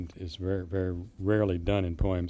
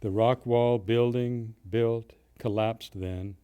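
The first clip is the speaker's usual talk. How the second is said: poem reading; 3 semitones higher, 4 dB louder, slower than usual. Acted. Poet reading his own poem about the past, measured recital. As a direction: read the verse in measured phrases with pauses.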